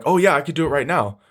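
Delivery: mock someone too keen to get the job; needy. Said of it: "you're just needy".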